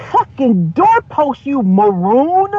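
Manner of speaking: slow, drawn-out scornful insult; exasperated insult. Retold or imitated. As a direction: spit it out with contempt. Venting at a caller.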